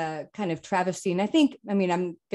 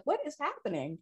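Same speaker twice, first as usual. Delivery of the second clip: bewildered; 7 semitones higher, 9 dB quieter than usual. Retold or imitated. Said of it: "I was like".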